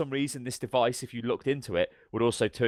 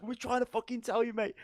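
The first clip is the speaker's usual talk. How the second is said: high, strained comic retort; mock exasperation; 12 semitones higher, 6 dB quieter than usual. Soldiers answering the rescuer in the minefield, mock exasperation. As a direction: imitate exasperated soldiers, pitched way up.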